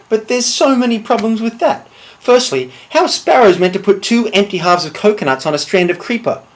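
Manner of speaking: exasperated rant. Acted. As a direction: argue it with mounting exasperation. Soldier rants about swallow theory.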